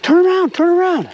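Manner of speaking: high, urgent call out to a buddy; yelled warning. Retold or imitated. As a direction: holler a warning down the trail. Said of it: "And I yelled out".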